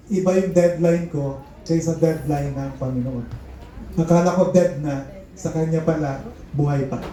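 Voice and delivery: ordinary delivery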